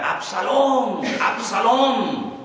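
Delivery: high, drawn-out cry of a grieving father; acted wail, pitched well up; grief-stricken cry. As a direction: call out the lost son's name in pain. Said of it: "comenzó a gritos en el palacio a gritar".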